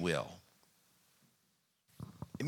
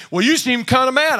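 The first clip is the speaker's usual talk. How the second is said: mock accusing; 9 semitones higher, 8 dB louder than usual. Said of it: "I've had people say".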